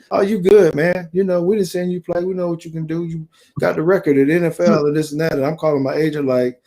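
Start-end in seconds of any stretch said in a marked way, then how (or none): 0.1-5.3 high-pitched, breezy brush-off; mock reassurance. Team staff brushing off a benched player, mock reassurance. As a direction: imitate staff smoothing over a complaint.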